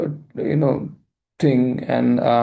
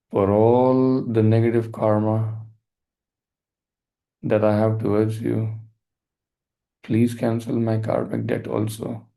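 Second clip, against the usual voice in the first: guided meditation; 4 semitones lower than usual. Acted. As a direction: lead the listener gently into forgiveness. Leads a forgiveness meditation.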